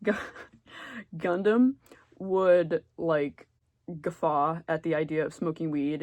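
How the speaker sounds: usual speaking voice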